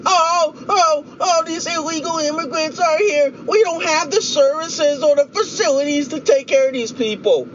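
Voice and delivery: high-pitched whiny mock crying; mock sob story. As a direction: whine it like a put-on sob story. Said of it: "a sob story saying".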